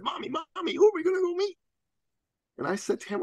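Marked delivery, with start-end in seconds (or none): 0.0-1.6 put-on high, eager little boy voice; a little boy tugging at his mom's sleeve; excited little boy. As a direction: squeak it like an excited kid. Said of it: "in that excited way little boys do".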